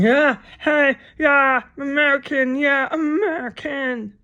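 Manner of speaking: high, loud mocking chant; mock rowdy Americans. Mocking the Americans in the backyards, high loud yell. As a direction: ham up the yelling neighbors. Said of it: "still in the backyards going".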